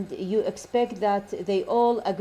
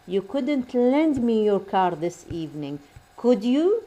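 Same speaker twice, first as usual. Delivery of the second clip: "could you" lifts at the end; polite request with a questioning rise on "could you"; rising intonation. Shown as a demonstration. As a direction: model the tag "could you" going up. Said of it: "rising intonation".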